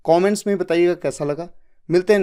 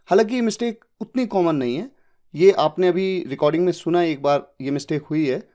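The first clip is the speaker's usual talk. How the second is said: ordinary delivery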